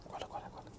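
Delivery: hushed conspiratorial whisper. Acted. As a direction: beckon them in a whisper. 扫地僧悄悄招呼. (悄声耳语)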